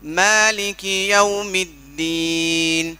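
मालिकी यौमिद्दीन chanted very slowly; tajweed recitation. Shown as a demonstration. Teacher models slow recitation for the student. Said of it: "recitation demo".

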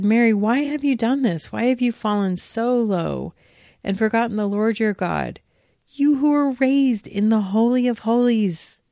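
high, loud accusing priest; rising outrage of a priest confronting Mary; stern priest rebuke. Acted. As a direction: scold her like an outraged priest.

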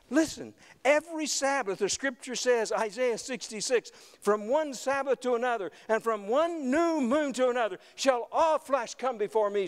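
high, emphatic scripture proclamation; impassioned preaching. Real, not acted. Preacher quoting Isaiah on every Sabbath, proclaiming it high and emphatic. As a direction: proclaim the verse to the whole congregation.

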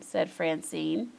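usual speaking voice

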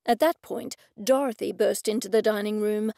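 plain reading voice

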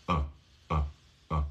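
low, soft "uh"; short repeated neutral "uh"; schwa. Shown as a demonstration. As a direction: say "uh" with a relaxed mouth. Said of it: "Ahí está la schwa".

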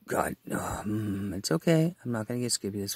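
ordinary talk, nothing marked

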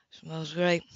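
low relaxed man in the kitchen; lightly voiced Ethan. A narrator's reading.

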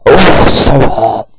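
loud, slow, exaggerated wail of pain; hammed-up hurt cry. Mocking the character's fake injury, loud and slow. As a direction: overact the pain, stretch it out. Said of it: "he goes like".

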